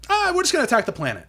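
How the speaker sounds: high, fast, breezy mock villainy; mock villain quote. Trade Federation dismissing the treaty, in a high fast mock tone. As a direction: toss it off like a cartoon bad guy.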